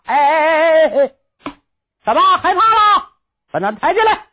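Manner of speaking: loud, jeering bandit call; mocking shout. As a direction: jeer at the prisoner loudly. 喽啰吆喝押犯人. (大声嘲弄吆喝)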